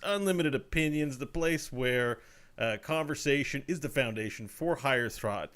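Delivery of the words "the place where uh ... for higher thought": usual speaking voice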